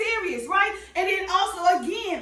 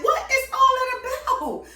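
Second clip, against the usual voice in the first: higher, slow disbelief; what is all that about, pitched up and drawn out; incredulous; 7 semitones higher than usual. Real, not acted. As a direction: ask it in disbelief. Exasperated at cussing.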